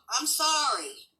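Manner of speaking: pleading sorry to a pet; coaxing apology. Real, not acted. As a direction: draw it out, cajoling the dog. Coaxing apology to her dog.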